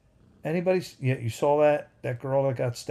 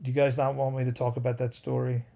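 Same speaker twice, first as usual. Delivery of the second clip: ordinary talk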